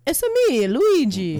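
high, bouncy game-character catchphrase; Luigi. Acted. Actor showing the character's only line, high cartoon Italian voice. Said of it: "all he says is, like".

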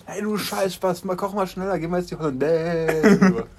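mock angry cook. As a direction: play an impatient cook hollering for Hollandaise. Acting an impatient cook giving orders.

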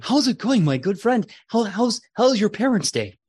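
put-on a proud kid checking on his friend; eager Gwendol. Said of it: "He goes".

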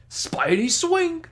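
very high, very slow loud cry of Spidey swing; hammed-up Spidey swing callout; Taskmaster voice line. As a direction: stretch Spidey swing like the game. Taskmaster answers wife joke.

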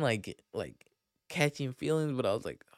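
ordinary talk, nothing marked

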